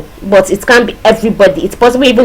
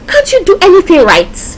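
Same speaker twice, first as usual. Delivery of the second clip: scolding parent; 8 semitones higher than usual. Acted. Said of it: "Maybe mommy at home or a sibling".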